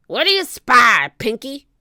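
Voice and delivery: loud pirate shout up to a crewman; pirate captain hollering; Captain Bones. A narrator's reading. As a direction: shout the question, loudest at the start. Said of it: "shouted Captain Bones".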